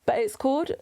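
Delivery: usual speaking voice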